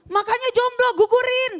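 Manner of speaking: high, loud snarky retort; exclaimed advice. Retold or imitated. Comic mocks a pregnant friend with loud blunt advice.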